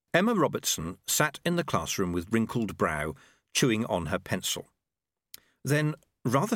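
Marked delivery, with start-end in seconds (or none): none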